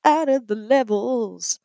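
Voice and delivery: stretched-out echo of the co-host's quip; slow mock repeat. Real, not acted. Echoing the co-host's joke about the levels, slow deadpan. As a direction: drag out "shit" as you repeat it. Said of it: "speaking the shit out of those levels".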